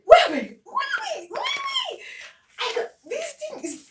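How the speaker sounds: high, loud laughing wait; giddy laughing outburst; laughing shriek. Real, not acted. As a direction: squeal through laughter. Laughing excitement at tripod.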